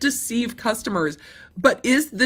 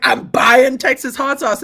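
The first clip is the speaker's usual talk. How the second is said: a louder mock-proud shopper; mock proud buyer; 5 semitones higher, 6 dB louder than usual. The Californian buyer boasting, louder and mocking. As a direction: announce the purchase like a proud shopper. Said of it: "being in California and being like".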